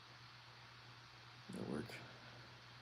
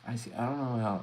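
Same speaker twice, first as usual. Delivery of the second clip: normal talking voice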